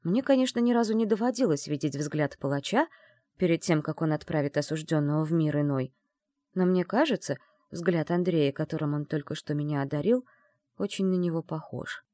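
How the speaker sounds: ordinary delivery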